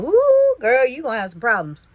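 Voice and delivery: a high, loud "Ooh" sliding into a knowing warning; sassy exclamation. Retold or imitated. The star warns the narrator about her boyfriend, sassy and loud. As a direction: tease her like a big sister who's seen it all. Retelling a star's teasing warning.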